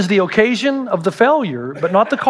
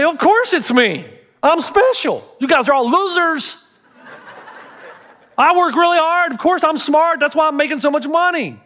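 arrogant boast; 7 semitones higher than usual. Retold or imitated.

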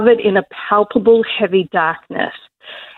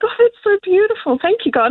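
much higher, gushing with delight; a thrilled little thank-you, pitched way up; delighted wonder; 11 semitones higher, faster than usual. Retold or imitated. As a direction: gasp it out like you just spotted a rainbow. Delight at rainbow, retold.

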